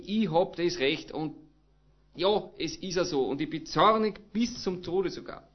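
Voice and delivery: ordinary talk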